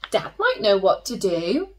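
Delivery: ordinary delivery, nothing marked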